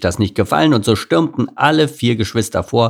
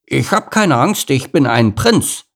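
put-on high, loud kid bragging; high childlike boast; boastful lion cub; 6 semitones higher, 4 dB louder than usual. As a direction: declare it proudly as a small boy. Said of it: "erwiderte Lars".

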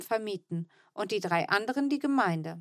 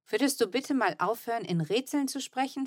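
plain reading voice

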